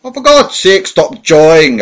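angry cry. Acted. Tramp losing patience with his captor, loud exasperated cry. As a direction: explode at him in desperation. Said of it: "I cried".

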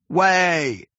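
high, drawn-out warning call; crying out. A narrator's reading. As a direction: call to clear a path. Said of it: "cried the men all about him".

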